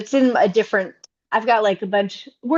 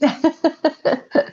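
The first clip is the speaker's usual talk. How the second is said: high bubbling laugh; 7 semitones higher than usual. Real, not acted. Laughs at wrap-up.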